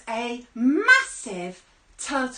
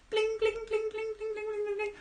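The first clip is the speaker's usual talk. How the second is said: high sing-song bling; 9 semitones higher, 3 dB quieter than usual. Acted. Fish dropping into bucket.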